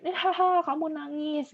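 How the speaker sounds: high, teasing "Eh, haha"; mocking laugh. Shown as a demonstration. Teacher acts a child laughing at a crying friend, mocking. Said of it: "bukan malah diketawain".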